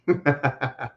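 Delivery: short, soft falling chuckle; quick low-key laugh. Real, not acted. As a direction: chuckle quietly at your own point. Laughs at his own joke.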